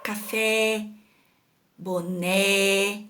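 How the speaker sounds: final É in "Café" and "boné" held wide open; open accented vowel. Shown as a demonstration. Teacher showing pupils the acute accent, open-vowel demonstration. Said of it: "pronunciamos com som aberto".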